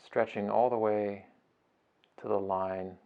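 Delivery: soft, unhurried meditation voice with long pauses; guided meditation. Acted. Teacher guiding listeners through a beach breathing meditation, soft and unhurried. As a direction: ease them gently through each breath, phrase by phrase.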